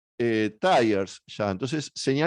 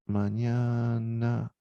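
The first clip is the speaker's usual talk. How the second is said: mañana drawn out very slowly, each sound held; slowly stretched word; 3 semitones lower, 4 dB quieter, much slower than usual. Shown as a demonstration. Teacher spells the word out for students, slowly stretched. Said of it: "dice acá".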